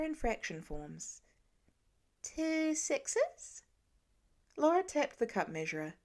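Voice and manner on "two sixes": put-on higher little boy, hesitant question; a kid testing an answer; unsure child guess. A narrator's reading. Nate guessing for Laura, higher and tentative.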